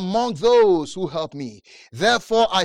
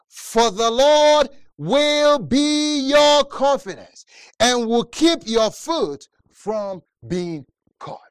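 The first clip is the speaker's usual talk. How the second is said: slow proclamation; 4 semitones higher, 6 dB louder, slower than usual. Acted.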